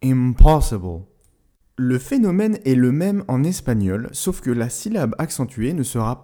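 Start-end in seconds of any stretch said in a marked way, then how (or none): 0.0-1.0 "impossible" louder and slower, POSS punched; stress on second syllable. Shown as a demonstration. Teacher repeating the English word with strong stress. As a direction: hit POSS hard in "impossible". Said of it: "l'accentuation se fait sur la deuxième syllabe".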